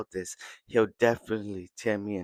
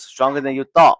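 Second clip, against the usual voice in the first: boastful taunt; 4 semitones higher, 11 dB louder than usual. Retold or imitated. Muten boasts as he takes over.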